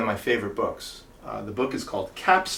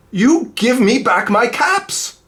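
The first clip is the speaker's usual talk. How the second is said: high, slow scolding; scolding monkeys; 10 semitones higher, 8 dB louder, slower than usual. Acted. Peddler scolding the monkeys in the tree, high slow. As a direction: wag a finger and scold. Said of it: "he said, shaking a finger".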